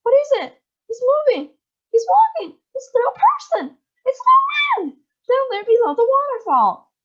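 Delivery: high, loud astonished shrieks; excited shrieking. A narrator's reading. Guests spotting the little man, shrieking. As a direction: shriek in disbelief at the sight.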